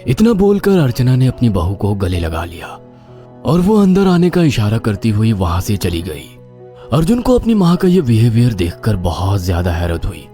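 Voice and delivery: ordinary delivery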